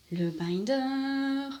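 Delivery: very slow, held high on the last syllable; drawn-out announcement. Real, not acted. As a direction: announce it with a long, stretched-out flourish. Announces the item with flourish.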